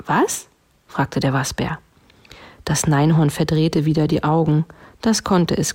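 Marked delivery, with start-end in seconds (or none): 0.1-0.5 high puzzled little question; questioning bear. A narrator's reading. Bear to the dog, high questioning voice. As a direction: ask high, as a baffled bear.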